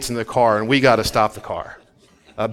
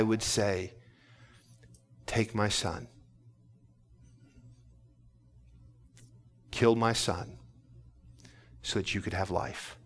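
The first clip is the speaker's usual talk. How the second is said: much softer, pulled right down; hushed sincerity; 2 semitones lower, 4 dB quieter than usual. Acted. Preacher confessing to the congregation he could not give his son, softly sincere. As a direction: confide it gently, like a father.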